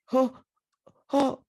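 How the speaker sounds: high, sharp panicked gasps; freaked-out gasps. Acted. Chambermaid seeing him fall, freaking out. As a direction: gasp twice in acted alarm. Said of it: "now I'm freaking out".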